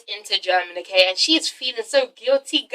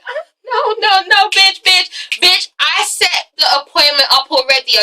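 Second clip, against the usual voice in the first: higher-pitched, indignant mock objection; sassy shutdown; 8 semitones higher than usual. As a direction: cut in with exaggerated sass. Acted quote of Yelena shutting them down.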